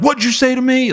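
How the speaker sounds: very high, hammed-up screaming instructor; screaming drill instructor. Drill instructor screaming at a recruit who answered back. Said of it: "they start screaming".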